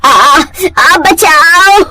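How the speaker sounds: loud, high scream while falling; falling cry for help. Acted. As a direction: cry out as you slip down the stairs. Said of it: "पैर सीढ़ियों से फिसल जाता है". (सीढ़ियों से गिरते हुए चीख)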